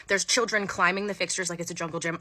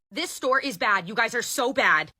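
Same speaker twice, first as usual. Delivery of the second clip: raised-pitch acted complaint; mock-indignant customer rant; angry customer; 3 semitones higher than usual. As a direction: scold the whole store. Angry customer berates manager outside.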